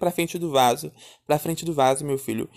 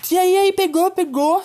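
high, louder mocking voice; cynical sister; 13 semitones higher, 5 dB louder than usual. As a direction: play the innocent sister who ran off. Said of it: "Cínica".

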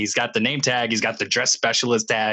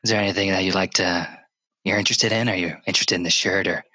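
low-pitched mock sales pitch; salesman voice; 6 semitones lower, faster than usual. Store clerk pitching shirts to his friend, salesman voice. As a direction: imitate a store salesman, lower than usual. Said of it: "he was using his salesman voice".

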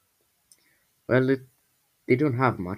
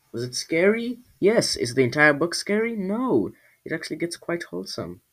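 much higher, bubbly aside to listeners; excited chat; 6 semitones higher than usual. Real, not acted. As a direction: tease the next chapters eagerly. Excited chatty outro to listeners.

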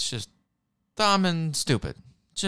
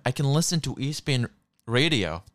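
usual speaking voice

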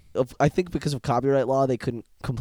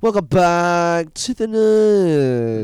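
loud, slow, high hype announcer; Keemstar impression; 7 semitones higher, 9 dB louder, much slower than usual. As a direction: bellow like a hyped news host. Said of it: "Like Keemstar?".

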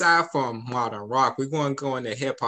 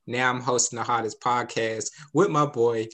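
usual speaking voice

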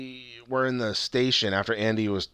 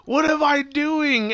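high, slow, loud wail of dismay; hammed-up, drawn-out horror; mock horrified realization; 5 dB louder, much slower than usual. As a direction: act out a man appalled at himself. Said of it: "his face was like".

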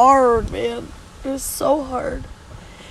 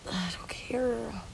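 breathless drained whisper, slow; 11 dB quieter, slower than usual. Real, not acted. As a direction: let it fall out as a tired whisper. Exhausted, numb about the beach.

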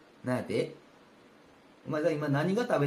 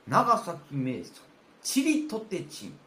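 announcing the name; much slower than usual. Shown as a demonstration. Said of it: "子供の名前につけたら".

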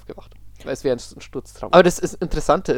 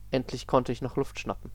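ordinary talk

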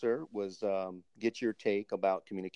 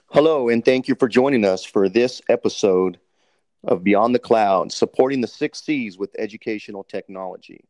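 radio host welcoming listeners, big announcer voice; 11 dB louder than usual. Acted. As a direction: boom out the show intro like a radio DJ. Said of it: "let me get my D J voice on".

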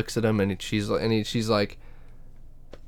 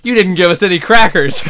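laughing mock voice; 6 semitones higher, 8 dB louder than usual. Acting Robot Chicken Jedi line, laughing.

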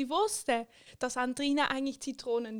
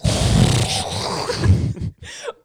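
loud laugh; 14 dB louder than usual. Real, not acted. Burst of laughter.

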